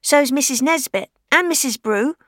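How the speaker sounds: a cheeky schoolgirl comeback; girl's retort. Acted. Fay answering the widow, high cheeky girl voice.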